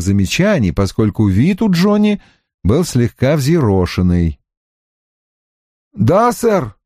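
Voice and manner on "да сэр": high, hurt protest; offended. A narrator's reading. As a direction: say it higher, as if wronged. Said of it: "произнёс он обиженным тоном". (обиженным тоном)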